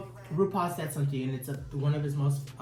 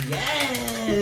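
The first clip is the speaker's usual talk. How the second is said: sassy drag queen; 7 semitones higher, 6 dB louder than usual. Retold or imitated. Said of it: "she's like".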